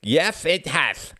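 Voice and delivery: "Yes, it has" high and slow with lisped s sounds; pretend lisp. A narrator's reading. Frederick mocks his own formal speech, lisping. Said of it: "pretending to lisp".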